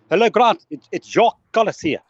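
put-on South African accent, high and loud; Jacques Kallis. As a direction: greet the host in a hammed-up South African accent. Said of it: "I've got a friend here from, uh, that's, uh, from South Africa".

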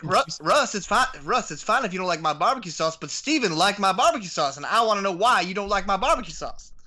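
a hint of a Southern accent like a barbecue sauce ad man. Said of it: "my best attempt at a Southern accent".

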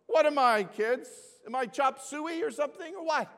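high, loud, hammed-up protest; mock indignation. Rejected grandfather protesting to the kids, mock indignant.

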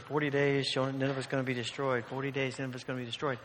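low, soft, droning repetition; unenthusiastic Jonah. Retold or imitated. Jonah grudgingly warning Nineveh, unenthusiastic. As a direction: recite the warning as a reluctant chore. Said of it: "I don't picture Jonah doing that with great amount of enthusiasm".

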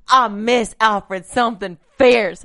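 slow, hammed-up Southern belle drawl; bad Southern accent on purpose. Shown as a demonstration. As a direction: stretch it out in a theatrical Southern accent. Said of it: "Scarlett O'Hara Southern accent".

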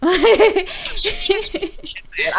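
high, slow, drawn out through a laugh. Real, not acted. Teased by the host about swearing, laughing it out. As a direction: savour the line while laughing.

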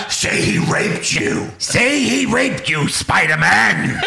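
slow menacing villain voice; hammed-up movie villain; Green Goblin. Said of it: "that was Willem Dafoe".

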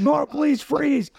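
mock police yell. Retelling the cop yelling at him, mock shout. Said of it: "And he yells again".

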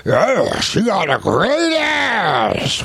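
a hammed-up De Niro shout, slow; De Niro impression. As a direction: ham up a gangster yell. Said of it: "Can you do De Niro?".

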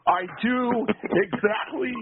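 defiant wrestler shout, slow; Roddy Piper impression. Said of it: "best Roddy Piper impression".

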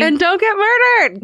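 very high, playful farewell; high-pitched sign-off. Real, not acted. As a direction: sing out the catchphrase way up high. Podcast sign-off catchphrase.

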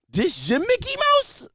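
high, drawn-out incredulous question; mock amazement. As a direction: gush in fake awe, pitch soaring up. Feigned awe at nephew's toy.

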